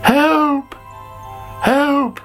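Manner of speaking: high-pitched panicky cry; calling for help. A narrator's reading. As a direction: call out in alarm, very high. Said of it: "called Sammy".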